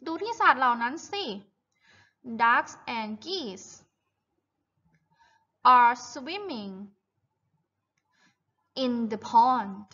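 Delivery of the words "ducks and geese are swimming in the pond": very slow, stretched-out sentence; model reading for repetition. Shown as a demonstration. Teacher reads the farm text for children to repeat, slow model reading. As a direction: read it out slowly, word by word. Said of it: "Repeat after me, guys".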